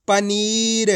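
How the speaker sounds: पनीर with the ee of नी held long; drawn-out reading. Shown as a demonstration. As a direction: stretch the middle of पनीर for learners. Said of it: "खींच खींच के पढ़ना है".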